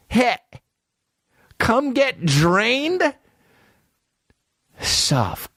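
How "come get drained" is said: much higher, slow, incredulous; high mock question. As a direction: drag it out in disbelief. Repeating ad line high.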